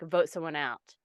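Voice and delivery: usual speaking voice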